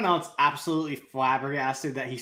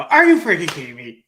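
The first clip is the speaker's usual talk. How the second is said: loud mock indignation; re-enacted outburst, much louder; retold outrage; 8 dB louder than usual. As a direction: exclaim it in exasperated disbelief. Retells own outraged reaction.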